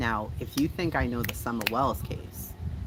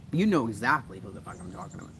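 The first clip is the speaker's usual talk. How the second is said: usual speaking voice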